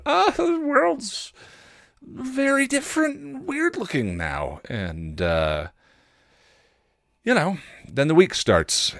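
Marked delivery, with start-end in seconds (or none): 0.1-4.6 mock bewildered reaction. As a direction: play a stunned, baffled version of yourself. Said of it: "my initial reaction to".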